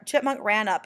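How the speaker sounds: plain reading voice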